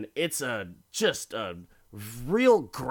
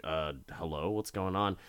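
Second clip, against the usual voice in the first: softer, unsure hello into the dark; hesitant quiet call; 5 semitones lower, 7 dB quieter, faster than usual. Retold or imitated. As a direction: call out softly into a dark room. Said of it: "She's like".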